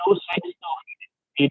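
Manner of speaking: choppy breaking-up phone line; broken-up cell signal. Real, not acted. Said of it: "it sounds like your phone's breaking up real bad".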